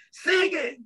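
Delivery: high, yelled call from the class; hollered out. Retold or imitated. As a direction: shout it across the classroom. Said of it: "somebody holler out".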